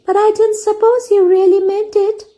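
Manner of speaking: put-on high girl's voice, disbelieving; girl answering slowly. A narrator's reading. Anne doubting the teacher meant it, slow. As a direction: protest to the teacher. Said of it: "said Anne slowly".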